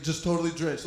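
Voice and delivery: usual speaking voice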